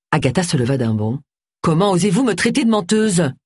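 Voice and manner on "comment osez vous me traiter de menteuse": higher-pitched offended outburst; affronted, raised-pitch protest; indignant. Acted. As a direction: challenge the accusation in outrage. Offended client leaps up.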